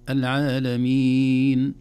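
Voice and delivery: loud العالمين with a long held ee; four-count madd. Shown as a demonstration. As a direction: chant العالمين slowly, stretching the last vowel. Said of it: "اثنين وأربع وست حركات".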